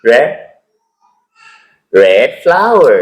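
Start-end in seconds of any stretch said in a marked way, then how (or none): 1.9-3.0 slow modeling. Shown as a demonstration. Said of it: "teacher models phrase for repeat".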